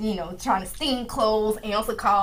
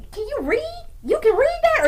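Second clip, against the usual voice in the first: mock-astonished, much higher-pitched questioning; amazed parent; 4 dB louder than usual. Voices surprised parent questioning son.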